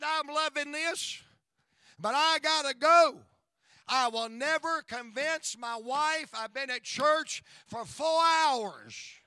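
put-on high, loud and slow old man's announcement; loud old visitor. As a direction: call it out over the service, hammed-up. Elderly visitor stands and excuses himself.

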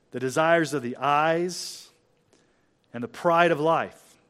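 loud, deliberate scripture reading; emphatic pulpit proclamation; preaching at full force. Acted. Preacher reads verse forcefully.

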